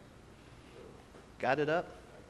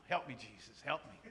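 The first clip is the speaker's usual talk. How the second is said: quiet plea; 6 semitones lower, 8 dB quieter than usual. Retold or imitated. Praying under his breath.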